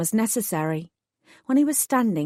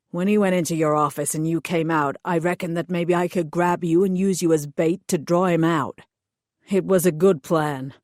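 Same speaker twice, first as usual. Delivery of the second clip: hired thug voice; 2 semitones lower than usual. Acted. Thug explains his orders to the heroine, sullen lowered voice. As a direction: play the sulky hired heavy explaining himself.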